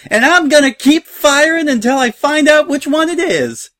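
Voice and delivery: high, loud threat hollered across to another ship; shrieking-high angry holler; furious yell. A narrator's reading. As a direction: yell it over at him with unrestrained fury. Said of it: "she yelled over at him".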